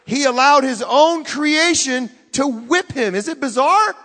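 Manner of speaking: loud, high, emphatic preaching; incredulous preaching. Real, not acted. Preacher marvelling at the whipping, loud and incredulous.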